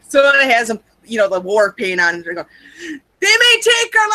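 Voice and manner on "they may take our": high, loud battle-cry shout; rally the troops with a rousing yell; mock-heroic war speech opening; William Wallace. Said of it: "my best interpretation of William Wallace".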